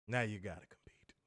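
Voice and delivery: soft whispered taunt. Shown as a demonstration. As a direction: whisper it like a secret. Repeats line whispered.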